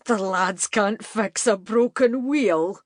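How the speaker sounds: mocking amusement. A narrator's reading. Evan mocks Katie's men, slow amused scoff. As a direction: savour the joke at her expense. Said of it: "he burst out laughing".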